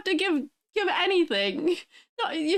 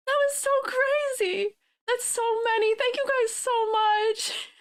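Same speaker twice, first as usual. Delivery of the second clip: higher, giddy disbelief; overwhelmed excitement; 5 semitones higher than usual. Real, not acted. Streamer sees seventy-two gifted subs and gushes with excitement. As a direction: gush over the numbers, near tears. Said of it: "try and calm down".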